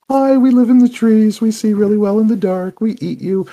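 very high-pitched, cutesy predator elf; mock-sweet elf. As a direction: chirp it like a friendly little man-eater. Acts out terrifying tree-dwelling elves.

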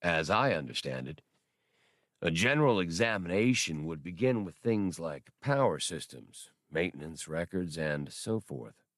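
low, slow drawling droid. Acted. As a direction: draw it out, low and unhurried. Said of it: "Bollocks drawled".

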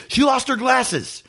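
high, slow announcement to the crowd; imitating the singer. Singer telling the crowd a fan lost glasses, high and slow. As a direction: pitch it way up and spell it out slowly. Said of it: "He goes".

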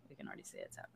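breathy whispered aside; whispering. Real, not acted. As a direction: whisper it grimly. Grim aside after rant.

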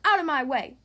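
loud, forceful burst; yelled order. A narrator's reading. Bella shoves past Boris into the party, yelling. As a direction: yell to clear the path. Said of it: "yelled Bella".

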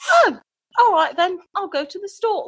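put-on high-pitched playful British accent. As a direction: agreeable mock-British reply, pitched high. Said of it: "learning British accent".

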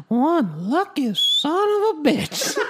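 put-on high, slow, strained old creep voice; Herbert voice. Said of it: "And he goes".

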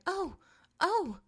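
sudden high excited gasps; joyful cry. A narrator's reading. Della crying out with joy at her gift. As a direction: burst out in delight. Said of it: "she jumped up and cried".

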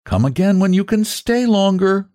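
high, sing-out invitation; girlish voice calling after him. A narrator's reading. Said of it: "said the voice".

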